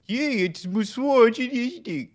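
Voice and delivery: high, slow British accent impression; mock British accent. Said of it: "You didn't even do your accent right".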